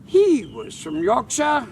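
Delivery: high, loud, slow British mimicry; mock British accent. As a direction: throw his British accent back at him. Mimics British man back at him.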